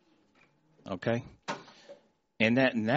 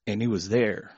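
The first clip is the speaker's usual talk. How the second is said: usual speaking voice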